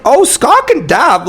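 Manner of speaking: giddy high disbelief, drawn out; thrilled fan gasping it out; a fan thrilled to see his hero dab, giddy and high; excited. Retold or imitated. Excited retelling of meeting.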